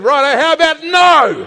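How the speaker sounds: loud, high, slow refusal; defiant no. Acted. The believer rejecting temptation with a loud, high no. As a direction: shout the no in defiance. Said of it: "you said".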